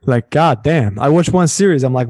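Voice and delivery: usual speaking voice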